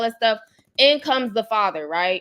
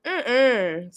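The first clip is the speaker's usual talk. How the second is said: slow, soft mock Baltimore accent on blue and shoe; imitating Baltimore accent; 3 dB quieter, much slower than usual. Teasing Baltimore speech to her viewers, slow mock accent. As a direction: drag blue and shoe out Baltimore-style, very slowly. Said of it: "talking like that".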